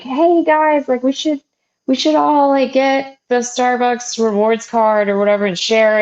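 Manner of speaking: high-pitched imitation of a passive-aggressive coworker. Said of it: "like, very passive aggressive".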